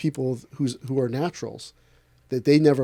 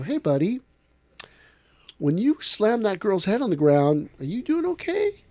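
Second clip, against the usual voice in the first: caring check-in; 6 semitones higher than usual. Retold or imitated. Teacher checks on student.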